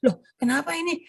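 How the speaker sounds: high, surprised little outburst from the crowd; startled audience. Retold or imitated. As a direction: play the audience member caught off guard. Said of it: "orang nggak akan kaget".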